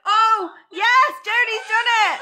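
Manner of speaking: high, excited shout; real excited cheer. Real, not acted. Quiz hostess hailing the first player finished, excited shout. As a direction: cheer the winner on, voice leaping up.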